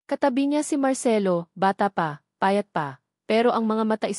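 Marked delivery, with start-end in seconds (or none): none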